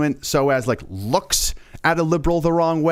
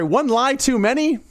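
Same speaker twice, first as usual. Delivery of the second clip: higher-pitched, disbelieving question; incredulous; 8 semitones higher than usual. Real, not acted. Host balking at the tweet's wording, incredulous. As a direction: query it as if you misheard.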